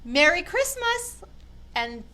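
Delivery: ordinary delivery, nothing marked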